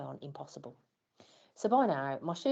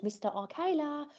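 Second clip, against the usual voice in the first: much higher call across a noisy hall; mild retold call to the leader; called out name; 11 semitones higher than usual. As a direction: call his name over the racket. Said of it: "waved to the leader and shouted".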